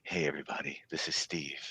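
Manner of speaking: low, hushed radio host voice; smooth late-night radio host; sultry narrator. Retold or imitated. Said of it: "with the sultry voice".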